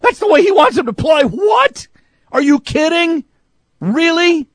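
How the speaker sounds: high-pitched, exasperated mock yell; retold yelling. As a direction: yell it at the radio in disbelief. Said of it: "But I yelled at it".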